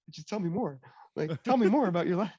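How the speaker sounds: giddy, eager pleading in a different voice; gushing fanboy to his mentor, giddy; all giddy. Retold or imitated. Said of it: "I'm, like, all giddy".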